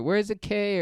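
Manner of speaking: high-pitched mock party squeal; screaming party people. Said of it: "People screaming".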